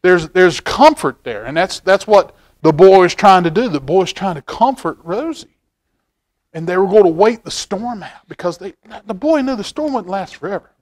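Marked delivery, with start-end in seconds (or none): none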